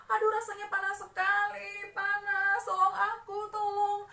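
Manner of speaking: high loud pained cries; begging through the pain; screaming for help. Acted. Suffering child screams to older sibling for help. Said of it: "si adik terus saja menjerit-jerit".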